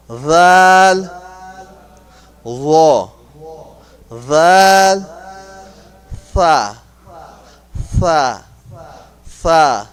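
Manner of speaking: "Dal", "Ra", "Tha" loud, each alone with a pause; letter drill. Shown as a demonstration. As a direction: model "Dal", "Ra", "Tha" for repetition. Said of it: "we're gonna practice that".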